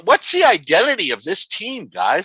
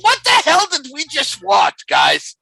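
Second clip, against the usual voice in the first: exasperated shout; 9 semitones higher than usual. Real, not acted. Exasperated outburst.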